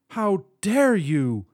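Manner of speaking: slow, loud, outraged growl; angry growl. A narrator's reading. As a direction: snarl it out slowly in fury. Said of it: "she growled".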